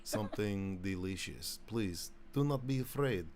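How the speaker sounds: comically hospitable Russian; Russian accent. Acted. Russian host offering vodka to a frightened guest, Russian accent. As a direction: coax the guest in a heavy mock Russian accent. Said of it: "My, my Russian accent".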